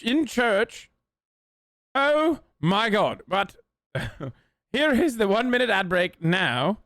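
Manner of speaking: high-pitched mock British voice; high, theatrical British accent; put-on British accent. Streamer puts on British voice.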